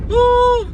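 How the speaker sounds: sudden high yelp of pain. Real, not acted. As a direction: yelp at scalding food. Burning mouth on hot food.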